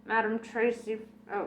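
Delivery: ordinary delivery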